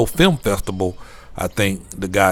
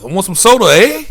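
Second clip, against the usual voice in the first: high, louder Canadian sing-song ending on eh; mock Canadian accent; 13 semitones higher, 6 dB louder than usual. Vlogger joking in a put-on Canadian accent, high and loud. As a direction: play the Canadian, asking for soda. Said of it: "I'm like".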